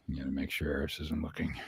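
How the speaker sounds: fast hushed aside; quiet aside. Real, not acted. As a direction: slip it in under breath.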